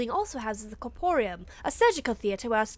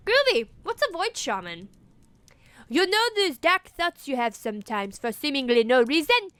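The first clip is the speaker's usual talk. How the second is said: normal talking voice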